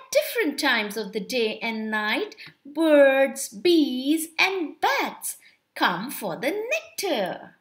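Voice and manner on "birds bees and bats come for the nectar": plain reading voice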